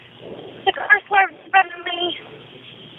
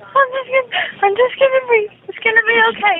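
sobbing, higher, trying to steady the breath; calming herself through real tears; real crying while trying to breathe; 3 semitones higher, faster than usual. Real, not acted. Caller crying, trying to calm herself.

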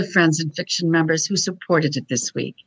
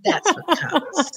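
said through a high laugh; 10 semitones higher, 5 dB quieter, slower than usual. Real, not acted. Host consoling a guest about her accent, laughing. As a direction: giggle the consolation out.

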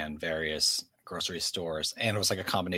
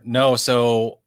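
emphatic loud; 5 semitones higher, 11 dB louder, much slower than usual. Real, not acted. Emphatic answer about credit card.

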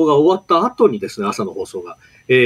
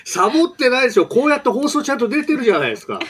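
indignant protest; 9 semitones higher, faster than usual. Real, not acted. Laughing protest against teasing.

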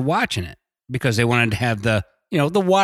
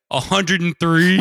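mock level report, slow and higher; imagined player's answer; 5 semitones higher, 3 dB louder, slower than usual. Friend imagined answering about his game level, drawn-out mock reply. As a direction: draw it out, voice raised in imitation. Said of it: "you're gonna be like".

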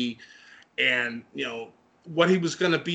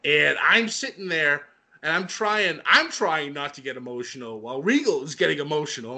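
usual speaking voice

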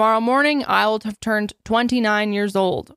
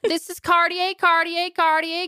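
put-on much higher, sing-song rush girl; mock sorority girl; 8 semitones higher than usual. Host mocks a Bama Rush girl's outfit video, much higher. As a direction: play the bubbly rush-video girl showing off her outfit. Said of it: "make fun of these people".

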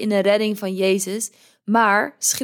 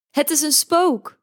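scared cry; 6 semitones higher than usual. Acted. Bijbelverhaal, discipelen roepen in angst.

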